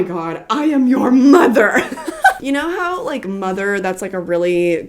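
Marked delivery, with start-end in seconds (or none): 0.5-1.8 loud, laughing read of the shirt. Real, not acted. Reading funny shirt. 1.9-2.4 high squealing laugh. Real, not acted. Laughing at shirt.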